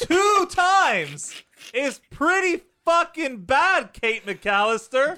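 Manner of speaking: high, loud, slowed outrage; indignant rant. Real, not acted. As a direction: rail at the careless mother.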